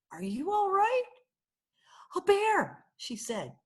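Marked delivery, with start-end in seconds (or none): 0.1-1.1 put-on high anxious child's question; ask, alarmed, if she's hurt; a girl rushing to a fallen old lady, worried. Child worried about fallen librarian. 2.1-2.9 high, shaken gasp of a word; an old woman on the floor, still scared of a bear; frightened old lady. Acted.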